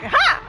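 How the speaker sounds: loud, high barking "ha"; one sudden high mock bark; imitated dog bark. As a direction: copy the dog's angry bark. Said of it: "He was like".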